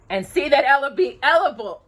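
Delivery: commanding. A narrator's reading. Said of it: "the king commanded".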